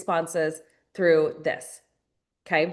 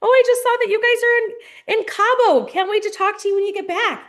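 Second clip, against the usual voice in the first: much higher and louder, bubbly and singsong; sugary follow-up message; 14 semitones higher, 6 dB louder than usual. Shown as a demonstration. Coach voicing a super-sweet follow-up text to a prospect. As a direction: gush like a cheerful friend checking in. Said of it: "you're checking in".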